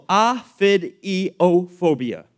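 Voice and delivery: OPHIDIOPHOBIA slowly, syllable by syllable; sounded out. Shown as a demonstration. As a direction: break OPHIDIOPHOBIA into separate, careful pieces. First sounding out a hard term.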